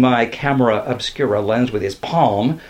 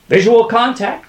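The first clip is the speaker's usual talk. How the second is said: loud, high call; urgent raised shout; crying out; 10 semitones higher, 6 dB louder than usual. A narrator's reading. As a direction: call out the sighting. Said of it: "cried Plantinga".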